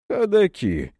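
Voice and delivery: a hint of mocking amusement; smirking. Hunchback answering Serafim with a light smirk. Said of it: "усмехнулся Горбатый".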